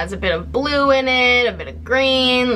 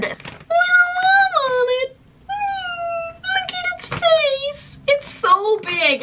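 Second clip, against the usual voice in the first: much higher, squealing over the cute bag; gushing squeal; slower than usual. Real, not acted. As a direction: melt over it, voice cracking high.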